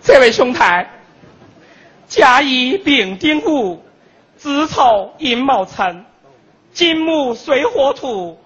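slow solemn chanting fortune teller. Retold or imitated. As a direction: intone it like a mystic master. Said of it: "有一个算命的".

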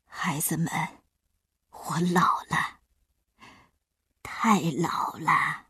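put-on whispered tearful old man; weeping. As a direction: whisper through tears. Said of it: "开始嚎啕大哭". (老爷爷带着哭腔低语)

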